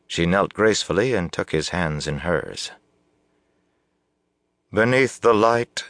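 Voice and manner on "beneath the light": high, slow ceremonial proclamation; strong, clear announcement. A narrator's reading. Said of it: "she announced in a strong, clear voice".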